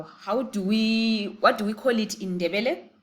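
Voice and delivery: ordinary talk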